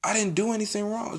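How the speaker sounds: high-pitched, softly retold denial; retold protest. Student denying blame to the teacher, high mock protest.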